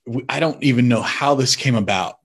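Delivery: usual speaking voice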